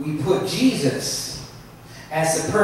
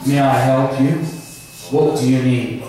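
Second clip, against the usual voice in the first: OnStar operator voice; 6 semitones lower than usual. Retold or imitated. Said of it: "his voice comes out and says".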